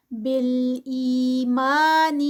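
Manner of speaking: بالایمان chanted slowly with two-count madd; two-count stretch. Shown as a demonstration. Teacher demonstrating the madd in recitation, slow. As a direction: hold the long vowels of بالایمان for two counts. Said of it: "ٹو counts".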